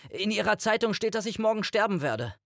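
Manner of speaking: very high, panicked blurt. A narrator's reading. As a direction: burst out with it in a high, strained voice. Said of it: "Dann stieß ich heraus". (panisch hoch herausgestoßen)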